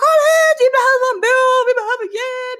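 very high, loud dramatic yell for help; high-pitched mock roar across the room; retold bellowing. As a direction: scream it out like an emergency, hammed up. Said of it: "jag bara typ vrålar där inne".

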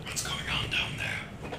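whisper. Pretend. Player whispers in character.

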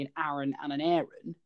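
first "Aaron" short A, second "Aaron" AIR-un; "Aaron" as AH-run, then "Aaron" as AIR-un; two Aarons contrasted. Shown as a demonstration. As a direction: contrast the opening vowels of "Aaron" and "Aaron". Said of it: "or are you just saying it wrong".

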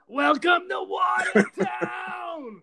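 high, slow hammed-up shout of a movie line; movie quote shout. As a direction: belt it like a trailer tagline. Quoting the Patriot Day movie.

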